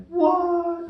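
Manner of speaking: high squeak of disbelief; shocked what. Retold or imitated. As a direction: shoot up in shock.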